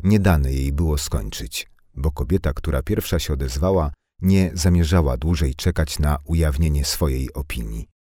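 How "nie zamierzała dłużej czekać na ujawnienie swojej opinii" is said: ordinary delivery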